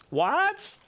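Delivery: high squeaked disbelief; stunned at what they read; shocked what. Real, not acted. Reacting to chat in disbelief.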